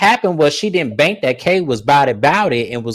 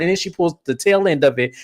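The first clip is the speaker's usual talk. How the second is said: ordinary talk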